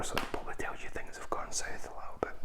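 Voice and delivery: fast conspiratorial whisper; stage whisper. Real, not acted. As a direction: murmur it like a secret aside. Joking aside about the cover.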